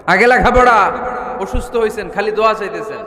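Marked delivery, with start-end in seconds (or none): 0.1-0.9 higher, raised-voice insistence; emphatic repeat. Real, not acted. Preacher insisting study comes before prayer, emphatic and high. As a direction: drive the point home in a raised voice.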